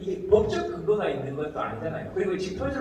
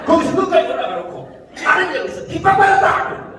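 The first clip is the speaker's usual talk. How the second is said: loud, high protest in mockery; hammed-up cry of persecution; mock outraged shout; 13 semitones higher, 10 dB louder than usual. Mocks a complainer, acted outburst. (억울한 척 높고 크게 외치기)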